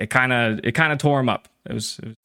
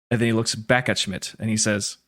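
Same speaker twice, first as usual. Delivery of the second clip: usual speaking voice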